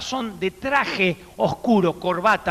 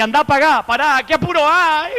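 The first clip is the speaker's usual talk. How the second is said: mock excited wife; 7 semitones higher, 5 dB louder than usual. Wife's retorts voiced.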